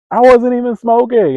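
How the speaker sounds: very loud, very high wail of injustice; outraged yell. Retold or imitated. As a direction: scream your innocence at full outrage. Protest repeated at higher pitch.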